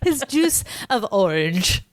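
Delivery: put-on slow, teasing old-timey echo; mock old-timey character. Teasing cohost's odd wording, mock old-timey delivery. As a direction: draw out "juice of orange" as mock-quaint. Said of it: "You're like an old-timey character".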